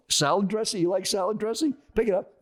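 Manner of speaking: high-pitched, insistent mock challenge; retold interrogation. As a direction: imitate a pushy stranger grilling someone. Acted stranger challenging his food.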